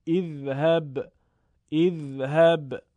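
measured recitation of اذهب with opening "i"; hamza read with kasra. Shown as a demonstration. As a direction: recite اذهب starting on an "i" sound.

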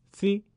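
سی said short as a short e; short kasra si. Shown as a demonstration. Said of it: "با صدای کوتاه کسره بخونید".

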